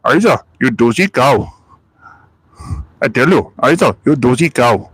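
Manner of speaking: Naveed impression. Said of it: "that's probably more Naveed and Still Game".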